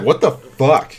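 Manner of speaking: high, loud startled outburst; startled, sudden disgust; surprised what the fuck. Retold or imitated. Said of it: "And it's like".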